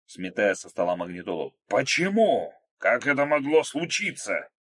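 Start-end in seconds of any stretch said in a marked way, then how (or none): 1.7-4.5 outraged, loud and high; terrible roar. A narrator's reading. Krot sweeping the radio off the table, outraged roar. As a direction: roar the questions in disbelief. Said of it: "страшным голосом заревел Крот".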